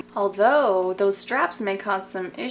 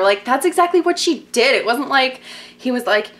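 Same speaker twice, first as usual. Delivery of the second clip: ordinary delivery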